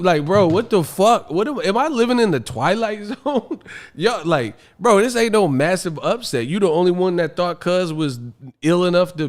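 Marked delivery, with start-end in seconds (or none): none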